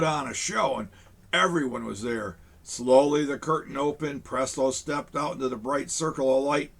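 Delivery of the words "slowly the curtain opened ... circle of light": ordinary delivery, nothing marked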